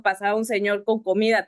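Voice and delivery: ordinary talk, nothing marked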